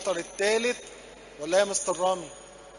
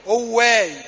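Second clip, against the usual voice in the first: loud model read; 2 semitones higher, 7 dB louder, slower than usual. Shown as a demonstration. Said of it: "صوت عالي".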